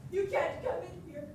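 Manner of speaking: ordinary delivery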